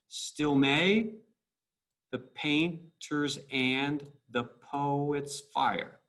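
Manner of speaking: "Still may" as one unit, then a clear pause before the next; two-syllable chunks. Shown as a demonstration. Teacher breaks a line down for students, slow two-syllable chunks. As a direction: slowly split "the painters and the poet's fire" every two syllables. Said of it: "let's break it down every two syllables".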